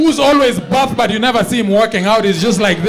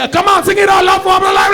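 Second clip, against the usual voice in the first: much higher, loud exhortation; mock worship leader shout; 11 semitones higher, 5 dB louder than usual. Worship leader urging the congregation, mock shout. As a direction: rouse the crowd like a hype pastor. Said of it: "Why are you saying".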